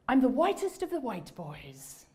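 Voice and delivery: lightly imitated fake British accent, slow; slow mock-British delivery. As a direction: put on a slightly hammy British accent. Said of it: "abruptly took on a fake British accent".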